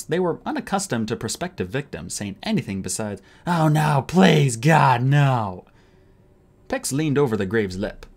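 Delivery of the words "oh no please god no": loud hammed-up pleading; a louder mock plea for mercy; mock begging. A narrator's reading. Narrator mimicking victims begging the thugs, loud mock plea.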